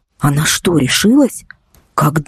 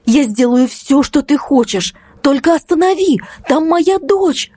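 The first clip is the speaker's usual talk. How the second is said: much higher, begging, slowing at the end; desperate pleading; 11 semitones higher than usual. Acted. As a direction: plead with the driver for her daughter. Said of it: "взмолилась я". (отчаянная мольба)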